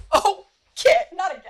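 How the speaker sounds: high, loud exasperated call; dismayed cry. Real, not acted. Host crying out when Kit gets stuck. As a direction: groan at the pet's mischief.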